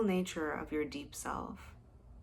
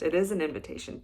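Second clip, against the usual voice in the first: "is" hit louder in "it is an invitation"; stress on IS; 7 dB louder, much faster than usual. Real, not acted. Host correcting her "almost" to listeners, stressing "is". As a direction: correct yourself, landing firmly on "is".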